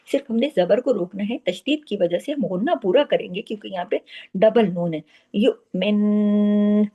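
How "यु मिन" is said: यु मिन drawn very slowly with long nasal hum; full ghunna. Shown as a demonstration. Teacher modelling the doubled noon for students. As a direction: stretch यु मिन, hold the nasal. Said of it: "इस तरीके से".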